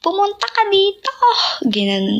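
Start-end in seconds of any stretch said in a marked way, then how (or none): 0.0-1.1 high imitated command shout; mock demanding yell. Singer imitating a fan yelling a command at her. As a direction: mimic a fan ordering her over, much higher. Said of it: "Inutusan niya kasi ako".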